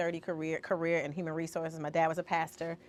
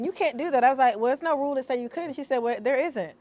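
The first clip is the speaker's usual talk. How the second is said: retold childhood back-and-forth; 6 semitones higher, 5 dB louder than usual. Retells childhood argument with mom.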